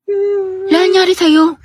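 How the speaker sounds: high, slow tearful voice; choked-up crying line. Acted. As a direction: speak through tears. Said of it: "Bakit ka naiiyak?".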